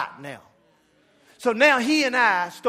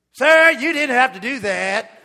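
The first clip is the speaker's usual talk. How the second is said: indignant protest; 3 semitones higher, 10 dB louder, slower than usual. Retold or imitated. Barista protests after being splashed.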